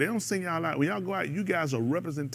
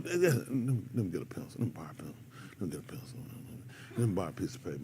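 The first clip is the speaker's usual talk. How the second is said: low, soft mumbling student; mock student; 11 semitones lower, 9 dB quieter than usual. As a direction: mumble it like a lazy student asking. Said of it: "You tell me".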